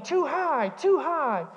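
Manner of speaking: high mock yell of a little girl; playful imitation of a toddler's yell; child yelling imitated. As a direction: yell it like a small kid on a swing. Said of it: "she would start yelling".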